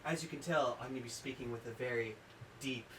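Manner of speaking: low, soft sultry voice; seductive deep voice. Acted. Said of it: "very deep, sexy, seductive voice".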